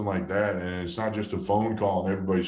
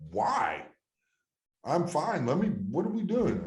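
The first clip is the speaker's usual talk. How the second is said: retold protest; 8 semitones higher than usual. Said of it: "I was like".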